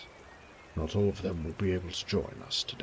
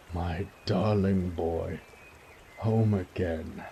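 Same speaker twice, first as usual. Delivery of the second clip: put-on slow, tender old father; tender welcome; slower than usual. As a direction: linger lovingly on each word. Father welcomes son home.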